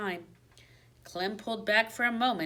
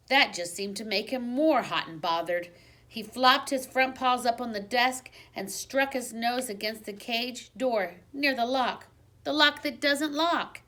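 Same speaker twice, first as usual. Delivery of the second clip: ordinary delivery, nothing marked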